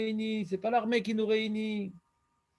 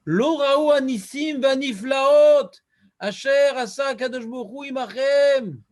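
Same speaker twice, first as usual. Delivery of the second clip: slow, louder Hebrew recitation; scripture reading; 4 semitones higher, 7 dB louder, slower than usual. Acted. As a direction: declaim the quote solemnly. Quotes the Rivash in Hebrew. (citation déclamée lentement)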